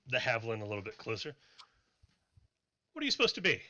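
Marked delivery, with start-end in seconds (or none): 2.9-3.6 dwarf sizing her up. Acted. Dwarf questions the paladin.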